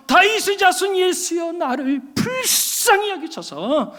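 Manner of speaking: high shouted call, slowing into a drawn-out plea. Retold or imitated. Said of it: "더 크게 소리를 지르면서".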